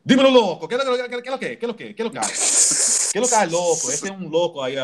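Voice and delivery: high, quick Dominican Spanish; Dominican accent. Retold or imitated. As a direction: rattle it off like a Dominican guy. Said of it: "And the Dominicans".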